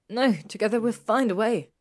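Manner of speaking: loud, high, urgent insistence; insist, refusing to give up; insistent plea. A narrator's reading. Hermione pleads against giving up.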